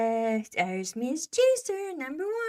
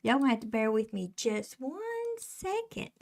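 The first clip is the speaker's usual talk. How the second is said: normal talking voice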